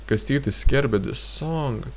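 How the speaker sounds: ordinary talk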